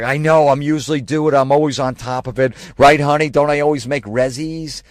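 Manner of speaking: high, loud mock girl voice; pushy girlfriend impression, loud and high; mimicked girl customer. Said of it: "And the girl's like".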